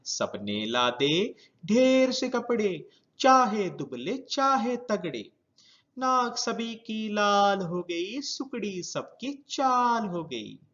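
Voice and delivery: put-on sing-song children's verse, drawn-out vowels; poem recitation. Teacher reads the whole winter poem to class, sing-song recitation. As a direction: recite in a flowing lilt, lines running together.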